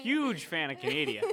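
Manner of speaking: put-on jokey Canadian accent with eh. Joking Canadian voice.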